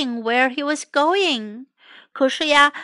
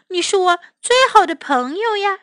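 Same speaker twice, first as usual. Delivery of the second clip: fond; 7 semitones higher, faster than usual. Acted. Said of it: "老虎说".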